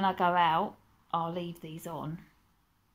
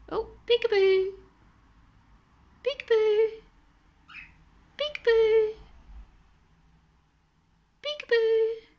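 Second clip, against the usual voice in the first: talking to a pet bird; 14 semitones higher, 5 dB louder than usual. Real, not acted. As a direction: coo up at the bird playfully. Playing peekaboo with the cockatiel.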